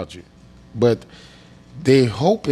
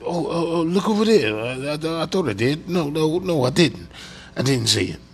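mock confused victim; 4 semitones higher than usual. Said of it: "the magician, like".

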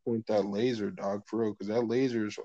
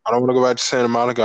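said louder and faster, more clearly; clearer, louder retake; 2 semitones higher, 11 dB louder, much faster than usual. Real, not acted. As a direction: speak it straight and loud this time. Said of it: "You have to speak straight if you wanna do this".

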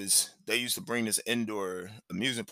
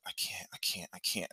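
breathless whispered panic, barely voiced; scared whisper; 4 semitones lower, 9 dB quieter than usual. A frightened son strapped into the coaster, whispered panic. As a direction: whisper it like a terrified kid who wants off.

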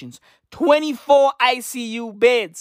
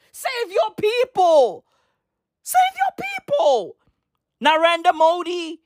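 desperate shout; 7 semitones higher than usual. Real, not acted. Begging the government to act, a high desperate yell. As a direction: plead at the top of your voice. Ranting plea at leaders.

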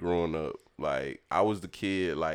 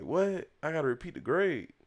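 put-on shocked kid; 9 semitones higher, 3 dB quieter than usual. Kid told he failed.

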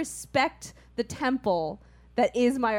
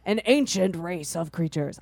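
movie trailer voice; 5 semitones lower than usual. Shown as a demonstration. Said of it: "I can't do it. My voice is too shot right now".

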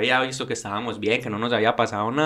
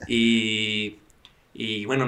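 usual speaking voice

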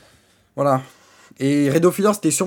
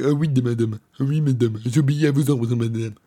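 lower, grovelling servant voice; bow and scrape before madame; hammed-up obedient lackey; servile yes-man; 5 semitones lower than usual.

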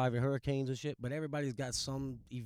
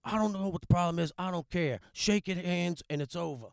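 raised, louder imitation of a coach laying down the law; stern coach; 4 semitones higher, 5 dB louder than usual. Coach ordering two fighters to make up, retold firmly. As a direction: settle it firmly, slowing at the end. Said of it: "He's like".

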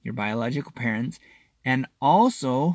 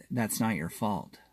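much softer, gentle therapist voice; quiet reassurance; 3 semitones lower, 8 dB quieter than usual. Retold or imitated. Robin Williams as therapist comforting Matt Damon, softly. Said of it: "Robin Williams says".

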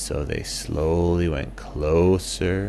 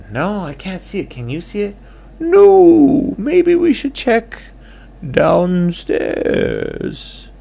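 high character voice; 10 dB louder than usual. Acted. Bat and owl voices.

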